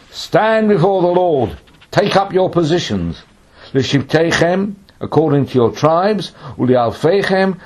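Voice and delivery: high, loud orders to a crowd; proclaimed command. Acted. Samuel ordering the tribes into position, proclaiming. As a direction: call the assembly to order with ringing authority.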